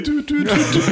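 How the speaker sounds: high giggly beeps; busy tone imitation. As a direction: mimic the dial tone through laughter. Imitating hang-up tone.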